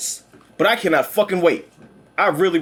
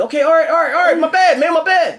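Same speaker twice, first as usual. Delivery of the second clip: high, louder, hurried backing down; high sheepish apology; 9 semitones higher, 3 dB louder than usual. Acted. Heroes apologising to the villain, high and sheepish. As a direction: plead sorry in a high voice.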